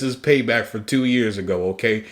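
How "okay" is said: ordinary talk, nothing marked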